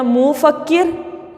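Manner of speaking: "मुफक्किर" with first syllable stretched to moo; wrong long vowel. Shown as a demonstration. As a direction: draw out the u in "मुफक्किर", moo-fakkir. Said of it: "इसको आप बोल, लिख रहे हैं". ("मुफक्किर" में मू को लंबा खींचकर)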